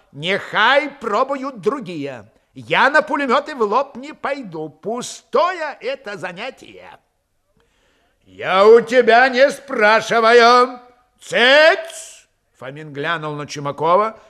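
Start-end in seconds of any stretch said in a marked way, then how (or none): 0.1-7.0 higher-pitched, stretched, curt-tempered objection; sharp refusal. A narrator's reading. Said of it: "Чумаков резко сказал". 8.2-12.3 loud, high, slow rebuke; angry silencing. A narrator's reading. Commander silences insubordinate man.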